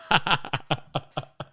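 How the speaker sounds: long burst of laughing; helpless run of laughs; real laughter. Real, not acted. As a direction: crack up at your own joke. Cracks up at own joke.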